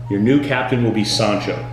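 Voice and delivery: ordinary talk, nothing marked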